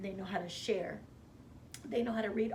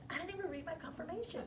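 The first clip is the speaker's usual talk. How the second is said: higher, softer, fast flustered rush; stunned disbelief; 8 semitones higher, 6 dB quieter, faster than usual. Retold or imitated. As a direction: blurt it out in shock. Stunned at the news.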